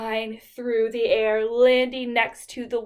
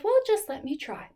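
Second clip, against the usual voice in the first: high, modest little fly; fly asking the animals for a chance; tiny fly voice; 8 semitones higher than usual. Acted. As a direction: ask gently, pitched up. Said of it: "the fly said".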